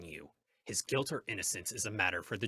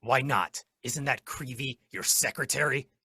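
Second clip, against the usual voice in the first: urgent challenge; 4 semitones higher, 6 dB louder than usual. Acted. Hornet challenges judge holding him back.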